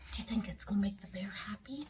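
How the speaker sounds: quick, soft confiding question; hushed whisper. Pretend. Hushed aside to children.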